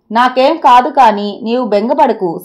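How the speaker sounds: ordinary delivery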